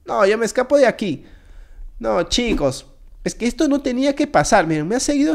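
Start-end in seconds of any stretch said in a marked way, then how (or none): none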